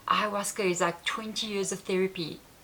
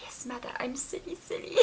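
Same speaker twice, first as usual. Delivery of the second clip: meek agreement; 5 semitones higher, 10 dB quieter than usual. Retold or imitated. Agreeing meekly with the spirit.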